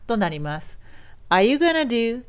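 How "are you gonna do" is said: "going to" shortened and linked into "gonna". Shown as a demonstration. Teacher shows how speakers shorten it, linked "gonna". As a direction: run "gonna" together as "gana". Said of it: "going toがよく短くなってつながってgonna".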